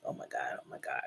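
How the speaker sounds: whispered panicked oh my God; hushed frantic whisper; anxious whisper. Retold or imitated. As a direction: breathe it out nervously. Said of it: "I'm like".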